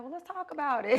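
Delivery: God calmly inviting her to talk, lifted and loud; God's voice. Retold or imitated. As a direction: play God answering her, easy and teasing. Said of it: "And he's like".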